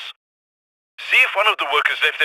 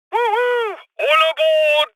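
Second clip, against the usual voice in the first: high, drawn-out conductor's cry; whoop, then holler it down the platform; gleeful high train call; 10 semitones higher, slower than usual. Acted.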